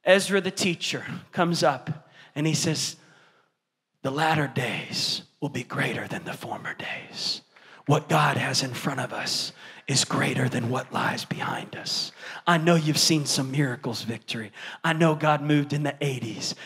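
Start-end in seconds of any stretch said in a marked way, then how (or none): 4.0-12.1 whispered scripture. Retold or imitated. Ezra the teacher speaks.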